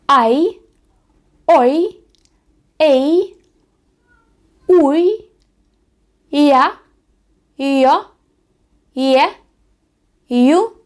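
дифтонги чітко; diphthong drill. Shown as a demonstration. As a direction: call out each sound clearly, pausing. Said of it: "є такі дифтонги".

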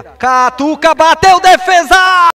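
high, loud shot call; excited shout. Real, not acted. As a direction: yell the save. Shot and save called.

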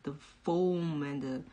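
normal talking voice

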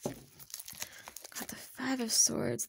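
slow whispered card names; whispering. Real, not acted. Reader whispering the cards she drew to herself. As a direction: breathe the card out under your breath, slowly.

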